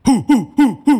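loud angry huffs, imitating the roommate; mock furious huffing; huffing in anger. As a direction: puff out breaths like a fuming roommate. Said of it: "然后他这样".

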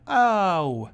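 imitating a loud sob-yell in the cinema; mock emotional yell. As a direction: mimic a theatergoer over-reacting. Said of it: "because they yelled. They went".

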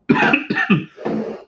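sudden hard cough; real cough. Real, not acted. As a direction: cough mid-sentence. Coughing fit mid-sentence.

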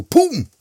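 high, loud "boom" dropping in pitch; imitated thud. A narrator's reading. Storyteller voicing Ka Mossel's fall, loud imitated thud. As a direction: burst out with the crash.

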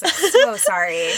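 high, slow, overdone "I'm so sorry"; mock apology. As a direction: ham up the apology with a lifted voice.

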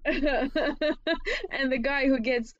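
slow, laughing through words. Real, not acted. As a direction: giggle while speaking. Laughing while describing scene.

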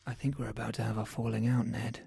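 soft, close ASMR murmur; breathy intimate ASMR voice; ASMR whisper. Acted. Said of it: "That was a bit of A S M R for Ned there".

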